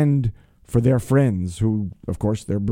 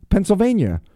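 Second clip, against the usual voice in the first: radio shout-outs; 5 semitones higher than usual. Acted. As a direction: call out each city with a showman's lift. Shout-outs to listener cities.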